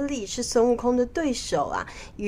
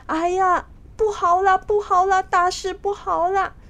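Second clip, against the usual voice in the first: high, urgent cry of bad news; alarmed official; 11 semitones higher, 4 dB louder than usual. Official rushing in to tell the king disaster has struck, alarmed. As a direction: raise the alarm in a high, loud voice.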